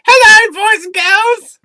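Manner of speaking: put-on very high, loud, slow kids' presenter voice; high kids' host. In the wig greeting boys and girls, high and loud. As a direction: greet the children like a pantomime dame. Puts on wig, new character.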